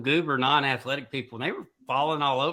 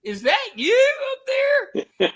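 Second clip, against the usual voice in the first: high, slow holler; calling up; 5 dB louder, slower than usual. Retold or imitated. Ski instructor shouting up to skier on the lift, calling out. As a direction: stretch it out to carry up a mountain.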